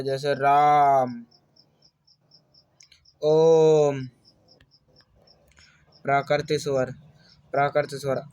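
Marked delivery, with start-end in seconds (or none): 0.0-4.1 राम and ओम drawn out very slowly, ओम loud and long; prolonged vowel demo. Shown as a demonstration. Teacher demonstrates a prolonged vowel, slow and drawn out. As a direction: hold the vowels in राम and ओम many beats. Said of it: "कई गुना समय लगता है".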